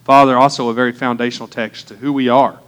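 hushed pastor's prayer. Acted. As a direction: pray it quietly and earnestly. Opening prayer before sermon.

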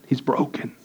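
very soft, emotion held back; close to tears. Real, not acted. Men speaking of their broken friend, retold very softly. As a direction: let it drop almost to nothing, real feeling. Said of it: "I'm gonna cry".

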